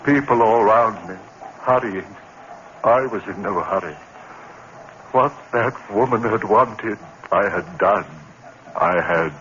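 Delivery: low, somber retelling; dazed confession narration; hushed narration. A narrator's reading. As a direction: recount it numbly.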